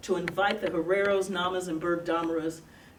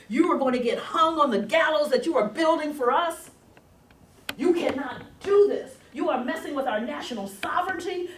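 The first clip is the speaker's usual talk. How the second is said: higher, louder indignant protest; mock outrage; 6 semitones higher, 6 dB louder than usual. British and South Africans protesting at the UN, indignant outrage. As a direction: ham up the offended empire. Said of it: "go ballistic".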